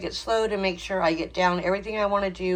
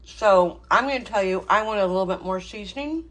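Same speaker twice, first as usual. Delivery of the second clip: ordinary delivery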